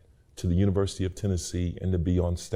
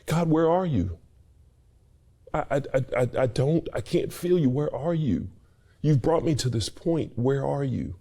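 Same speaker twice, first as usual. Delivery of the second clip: emotional; 9 semitones higher than usual. Real, not acted. Tearful retelling of losing home.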